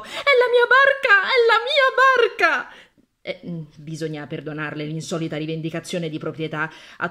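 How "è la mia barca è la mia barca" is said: high, loud, overjoyed cry half laughing and half sobbing; joyful shout. A narrator's reading. Cleaning woman bursts from behind the bins, claiming the boat, shouting high and loud. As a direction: shout it out, she has found her boat. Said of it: "uscì correndo da dietro i bidoni e urlò".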